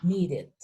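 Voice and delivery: "Needed" said nee-rid, falling. Shown as a demonstration. Teacher repeating the model word, ID ending. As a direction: end "Needed" in a short -id. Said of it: "Va a sonar como una I D".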